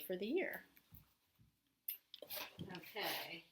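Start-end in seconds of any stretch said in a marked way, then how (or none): 2.7-3.4 breathy under-the-breath okay; whispered okay. Real, not acted. Teacher muttering to herself while writing, whispered.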